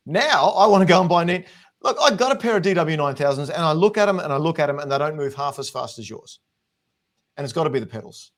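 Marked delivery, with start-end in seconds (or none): 0.1-6.4 loud, high mock envy; sarcastic. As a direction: overdo the praise as a joke. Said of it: "I'm being sarcastic".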